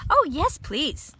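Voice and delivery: a little one keen to eat; high eager yes; eager tiny voice. A narrator's reading. Eulalie eager for food.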